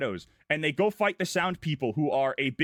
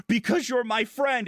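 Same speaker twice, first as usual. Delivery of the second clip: earnest mock cry; 6 semitones higher than usual. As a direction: belt it out like an anime hero. Voicing Naruto's heartfelt cry.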